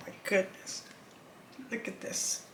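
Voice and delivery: hushed, breathless look-at-this; awed whisper. Real, not acted. Reader whispering to the viewers at a surprising card. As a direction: whisper it as if the card stunned you.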